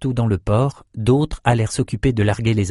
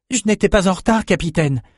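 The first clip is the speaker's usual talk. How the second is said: put-on high, out-of-breath young sailor; lightly acted breathlessness, pitched up; panting; 9 semitones higher than usual. A narrator's reading. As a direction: protest to the captain after a run. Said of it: "haleta Bannon".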